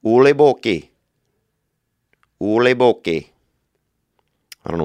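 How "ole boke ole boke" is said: a mock Norwegian singsong, a bit louder; Norwegian sound. Shown as a demonstration. Showing listeners a mock Norwegian sound. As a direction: toss off a fake Norwegian phrase. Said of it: "that's like a Norwegian type of sound".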